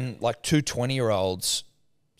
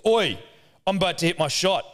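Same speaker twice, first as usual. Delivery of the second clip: mock challenge; 6 semitones higher than usual. Young golfer calling to passing players, mock challenge. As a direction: call out sharply as the cocky golfer.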